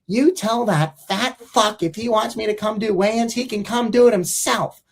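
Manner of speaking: high, mock-indignant player voice; hammed-up gruff retort; Phil. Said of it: "And Phil's like".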